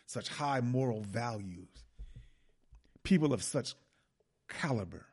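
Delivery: loud declaiming speech to parliament; dramatic oratory. Acted. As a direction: proclaim it like a grand speech. Reads Macaulay's address dramatically.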